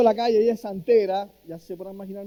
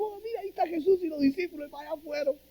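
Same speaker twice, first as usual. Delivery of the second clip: much higher, fast, excited cry; excited crowd; 11 semitones higher, 6 dB quieter, faster than usual. Acted. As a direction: blurt it out like someone spotting a celebrity. Crowd spots Jesus.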